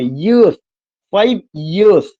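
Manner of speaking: "Years" swelled and drawn out after a light "five"; stress on YEARS. Shown as a demonstration. Teacher drilling where the stress goes, "years" stressed. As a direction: punch and stretch "years". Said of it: "ये जो जोर देना पड़ रहा".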